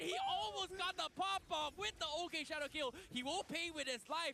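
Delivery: esports caster. Real, not acted. Excited co-caster reacts to a play.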